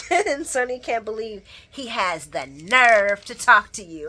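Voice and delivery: ordinary delivery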